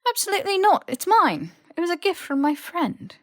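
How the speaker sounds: high, loud, clinging to it; protective outburst. Acted. Harry refusing to give Malfoy the badge back, loud protest.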